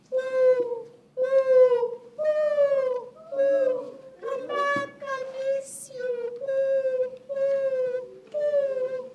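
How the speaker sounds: high hooting owl call; lonely owl calling. A narrator's reading. Owl calls to friends in a far tree, high plaintive hooting. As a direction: hoot high and longingly. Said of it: "and he went".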